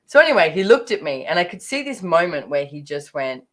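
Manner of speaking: usual speaking voice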